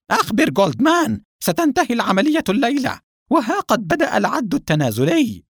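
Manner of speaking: high, forceful irate tirade; irate boss barking orders; angry interruption. A narrator's reading. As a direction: cut in furiously and build to loud, high orders. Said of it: "قاطعه القصير بغضب".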